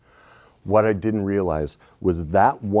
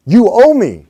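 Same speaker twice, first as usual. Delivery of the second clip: entitled demand; 9 semitones higher, 11 dB louder than usual. Pretend. Mock spouse demanding.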